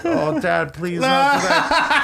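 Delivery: put-on pleading kid, voice climbing high; embarrassed son. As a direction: imitate a child begging Dad, slowing and rising. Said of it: "his poor son".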